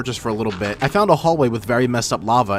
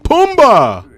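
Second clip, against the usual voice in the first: loud, much higher shouted name; shouted call; 14 semitones higher, 10 dB louder than usual. Acted. Shouting a meme cry at the screen. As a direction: yell it out like calling across a field.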